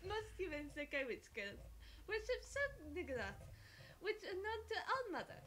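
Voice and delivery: put-on playful French-accented storyteller; horrible French accent. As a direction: tell it with a hammed-up French accent. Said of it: "Excuse my horrible French accent".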